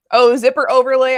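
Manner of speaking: loud, high excited exclamation. Real, not acted. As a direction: burst out with delight. Excited over a pattern feature.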